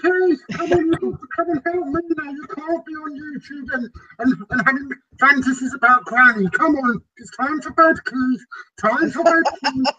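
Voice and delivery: high, nagging wife's call; wife calling him. Pretend. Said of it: "I think your wife's calling you".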